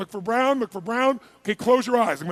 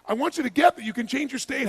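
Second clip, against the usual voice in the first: usual speaking voice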